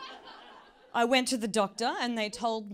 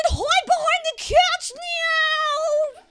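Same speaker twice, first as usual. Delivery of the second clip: very high, loud, slow whimpering dog voice; dog greeting owner at the door, high tearful squeal; fragile dog voice; 11 dB louder, much slower than usual. Pretend. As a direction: squeal like a frantic pet. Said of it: "I should do that for the cameras again".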